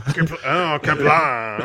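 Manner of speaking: mock French Klingon cry; drawn-out French Klingon exclamation; French Klingon accent. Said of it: "It's French Klingon".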